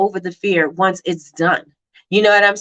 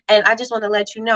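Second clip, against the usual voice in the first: normal talking voice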